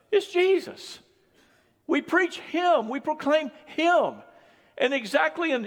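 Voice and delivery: high, forceful sermon proclamation; impassioned preaching. Real, not acted. Preacher telling the church it's Jesus, not programs, impassioned preaching. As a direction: proclaim it to the whole room, punching key words.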